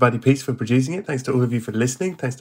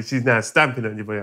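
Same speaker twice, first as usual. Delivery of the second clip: normal talking voice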